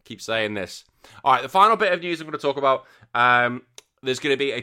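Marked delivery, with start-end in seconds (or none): none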